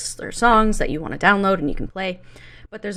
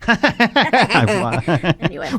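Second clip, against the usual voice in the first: slow nasty goblin drawl; 4 dB louder, much slower than usual. Acted. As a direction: stretch it out like a sneering goblin. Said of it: "What the fuck was that".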